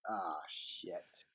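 whispered mock dismay; low, whispered reenacted letdown; hushed dismay. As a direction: let it out under your breath, sinking. Retold dismay in the taxi.

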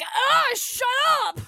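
very high, slow shrieking fangirl; shrill squeal. Retold or imitated. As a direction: squeal it like a flustered teen. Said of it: "You're like".

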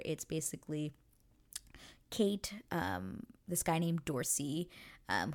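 ordinary talk, nothing marked

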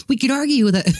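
mock a self-important History Channel narrator; hammed-up pretentious documentary guy; pretentious TV host. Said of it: "the really pretentious guy is like".

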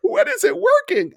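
very high, whining client; whiny client. Retold or imitated. Sweating client asking his trainer, high and whining. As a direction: whine it up high.

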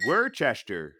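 "Worcester" insisted on as WUR-chester; deliberate mispronunciation. Shown as a demonstration. Joker refuses his co-host's correction, mispronounced as WUR-chester. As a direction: say "Worcester" as spelt, with a "chester" ending. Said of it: "I'm gonna mispronounce every city".

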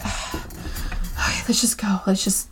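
whispered, drained mutter to get away; soft weary whisper; exhausted whisper. Retold or imitated. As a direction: breathe it out, totally spent. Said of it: "Victoria's just like".

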